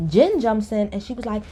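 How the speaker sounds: ordinary talk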